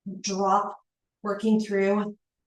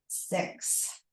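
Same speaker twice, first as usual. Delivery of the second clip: ordinary talk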